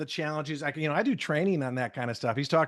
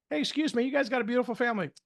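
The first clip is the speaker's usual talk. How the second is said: friendly compliment demo; 8 semitones higher, much faster than usual. Shown as a demonstration. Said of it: "is the best way to break the ice".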